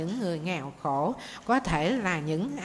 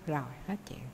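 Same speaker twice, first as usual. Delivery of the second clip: ordinary talk, nothing marked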